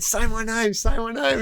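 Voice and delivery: high-pitched mock demand; mimicked boastful mate. Mate's bedroom boast acted out.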